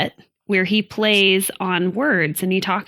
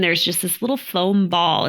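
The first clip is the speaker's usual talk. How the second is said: ordinary delivery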